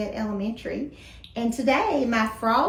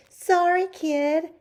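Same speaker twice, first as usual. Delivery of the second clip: loud, raised-pitch call; 6 semitones higher, 6 dB louder, slower than usual. A narrator's reading. Hank's mom to frog boy as she leaves, calling out. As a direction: toss it out loudly as a story character. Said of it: "Hank's mom called".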